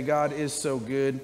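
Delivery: soft, low prayer voice; closing prayer. Acted. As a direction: pray gently over the church.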